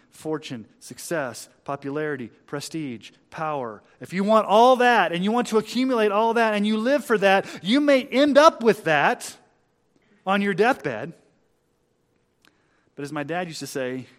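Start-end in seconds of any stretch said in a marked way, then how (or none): 4.0-11.1 loud, high, driving warning; impassioned pulpit delivery; preaching at full force. Real, not acted. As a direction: raise the voice and press the warning home.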